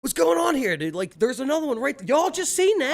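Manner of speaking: excited. Retold or imitated. Guards freaking out, retold.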